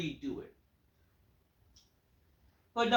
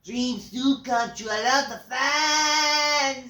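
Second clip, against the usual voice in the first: slow, loud hammed-up accented promo; mock Spanish accent; 5 semitones higher, 7 dB louder, slower than usual. Reviewer repeats the promo line, slow loud Mexican-accent parody. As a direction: drag it out mocking the accent. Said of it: "they say".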